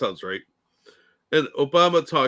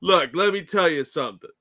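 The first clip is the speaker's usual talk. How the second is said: imitating stutter; 7 semitones higher, 5 dB louder than usual. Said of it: "that little stutter thing".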